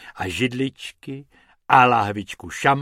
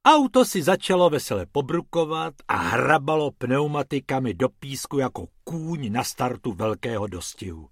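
ordinary delivery, nothing marked

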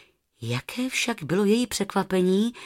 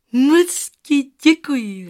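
put-on higher, louder little girl, slow; smiling thanks; 6 semitones higher, 8 dB louder, slower than usual. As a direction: thank the goose with a smile. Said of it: "řekla a usmála se na husu". (holčička vděčně s úsměvem)

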